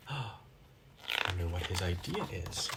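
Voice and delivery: low hushed aside. Real, not acted. Reader gasps, hints secret.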